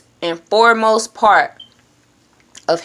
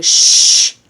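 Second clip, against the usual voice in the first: a long unvoiced shh; shushing; 6 dB louder than usual. Shown as a demonstration. Vlogger tells his racing mind to hush. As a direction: hush the thoughts firmly. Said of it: "I often tell myself".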